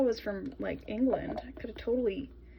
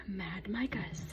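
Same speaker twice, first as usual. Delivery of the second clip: whispered aside; 3 semitones lower, 12 dB quieter than usual. Real, not acted. Aside naming the supplier.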